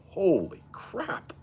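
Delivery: drawn-out amazed exclamation; surprised. Real, not acted. Reacting to a chat bike feat.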